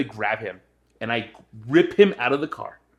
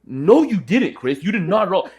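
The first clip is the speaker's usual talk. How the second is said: insistent retort; 7 semitones higher, 4 dB louder, slower than usual. Retold or imitated. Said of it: "And I'm like".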